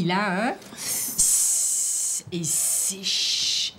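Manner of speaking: long hissed "s", then long hushing "ch"; contrasting S and CH. Shown as a demonstration. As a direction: draw out "s" and "ch" as pure voiceless sounds. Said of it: "C'est différent".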